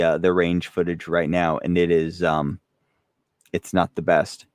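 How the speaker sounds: normal talking voice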